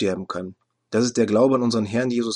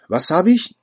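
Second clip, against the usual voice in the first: higher, louder outraged questions; retold indignant outburst; 3 semitones higher, 5 dB louder than usual. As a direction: bristle at the insult. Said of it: "Ich fuhr empört auf".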